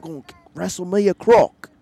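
put-on loud, high Australian accent; Crocodile Dundee. Host shows his Australian accent to his unimpressed wife, loud and high. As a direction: belt it out like an Aussie croc hunter. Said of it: "mine is like it".

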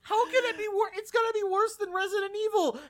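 high-pitched, aghast disbelief; incredulous. Real, not acted. Disbelief at the bad news.

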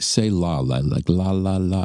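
"la" with an open "ah", repeated; imitating her "la" demonstration; open "la" sound. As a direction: coach the "la" syllable as LAH. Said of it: "she pronounced it like that?".

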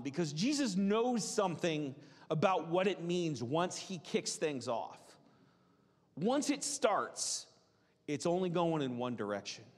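ordinary talk, nothing marked

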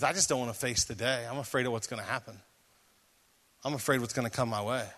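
low, hushed and quick; soft, low imitation of an anxious confession; subdued retold confession. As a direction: quietly voice someone admitting dread. Retells anxious woman's confession.

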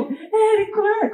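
high, thrilled repeating of the name; excited call. Retold or imitated. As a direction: call his name bursting with joy. Retelling excited message to Érico.

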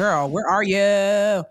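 slow, drawn-out friend's call. Retold or imitated. Best friend stalking her when she hid out, drawn-out calling. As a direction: stretch every word, hunting for your bestie.

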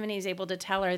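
ordinary delivery

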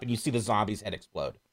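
ordinary talk

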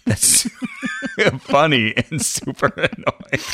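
laughing through the words. Real, not acted. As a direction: giggle the words out. Laughing at wind idea.

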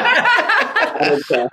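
high, slow, drawn-out mock dad; gruff dad dismissal. His dad brushing off a ten-year-old's protest, slow and high. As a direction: stretch it out, dismissive. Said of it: "he's like".

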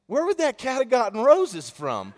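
high, loud mock bafflement; baffled wife. Wife puzzled by rose petals.

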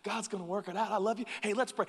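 lightly voiced, breezy, cheerful friend; a hint of a sunny church friend; upbeat believer. Mimicking a hopeful friend who offers prayer, lightly voiced. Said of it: "They're just like".

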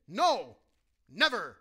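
loud, punched no and never; emphatic refusal. Acted. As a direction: slam each word down as a flat refusal. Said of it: "He's being emphatic".

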